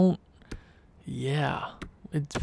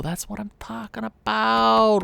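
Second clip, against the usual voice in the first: pleased cheer; 4 semitones higher than usual. Real, not acted. Celebrating a winning streak.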